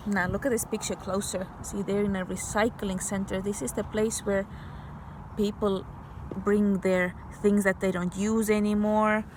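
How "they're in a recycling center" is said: ordinary delivery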